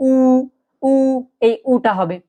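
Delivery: long U through rounded lips; drawn-out "উ" with a rounded mouth. Shown as a demonstration. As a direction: round the lips fully and hold "উ". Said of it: "ঠোঁট গোল করে উ বলি না".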